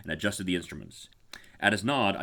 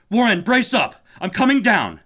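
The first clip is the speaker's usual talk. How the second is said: high, loud determined shout; rally him with a shout; shouted resolve; 13 semitones higher, 11 dB louder than usual. Acted. Carter shouting down to Warren, resolute. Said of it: "shouted a resolution".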